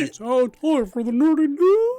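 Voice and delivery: slow, high, loud parody of the intro; mocking announcer. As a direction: ham up the cheesy intro voice. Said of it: "and he goes".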